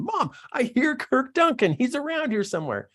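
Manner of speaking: imitated child in a high voice; high-pitched kid trying to get mom's attention; insistent kid. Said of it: "he's tapping his mom on the shoulder saying".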